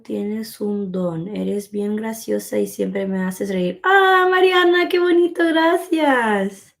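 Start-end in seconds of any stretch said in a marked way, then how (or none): 3.8-6.7 much higher and louder, thrilled; delighted thanks. Real, not acted. Thanking Mariana for the kind words, delighted and much higher. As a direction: gush your thanks.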